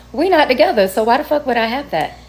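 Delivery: indignant retort. Retold or imitated. Retorting about his missing backpack.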